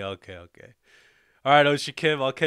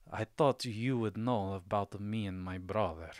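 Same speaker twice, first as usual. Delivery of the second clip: put-on Sokovian accent; 5 semitones lower, 5 dB quieter than usual. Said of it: "I'll try to do, okay, a Sokovian".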